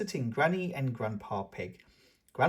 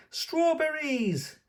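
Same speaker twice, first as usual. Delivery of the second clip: high, slow, delighted gasp; gasping Peppa; much slower than usual. Acted. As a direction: gasp it out like a thrilled little girl. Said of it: "gasps Peppa".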